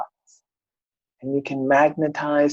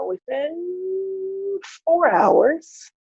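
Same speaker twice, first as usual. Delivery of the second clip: drawn out; 12 semitones higher, much slower than usual. Retold or imitated. Drawn-out excited telling.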